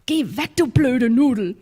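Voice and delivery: mock-rude little girl in Palatinate German dialect; Palatinate dialect child. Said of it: "in that very deft German dialect".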